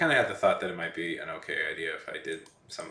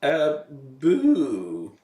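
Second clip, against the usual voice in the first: startling boo; 6 semitones higher, 8 dB louder than usual. Pretend. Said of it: "I'm gonna startle you".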